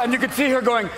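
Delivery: ordinary delivery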